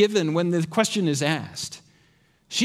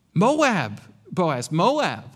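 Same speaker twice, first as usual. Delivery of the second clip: "Moab" hit firmly, then "Boaz", "Moab" again; "Moab" punched with a falling tune; stress on MOAB; 2 semitones lower, much slower than usual. Shown as a demonstration. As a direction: lean hard on each "Moab". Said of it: "so much emphasis on that word".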